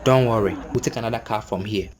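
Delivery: low, softening, contained reply; composed reassurance. Retold or imitated. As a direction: reassure calmly while holding back irritation. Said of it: "I kept my composure".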